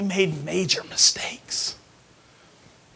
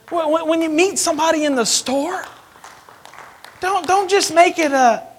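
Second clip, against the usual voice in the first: much higher, louder exhortation; preaching loud; 10 semitones higher, 9 dB louder than usual. Real, not acted. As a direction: preach it out, full force. Preaching at full force.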